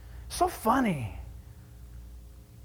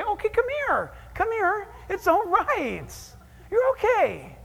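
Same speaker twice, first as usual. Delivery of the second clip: tender welcome; 10 semitones higher than usual. Acted. God welcomes the repentant sinner, higher tender call. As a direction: coax a child back into your arms.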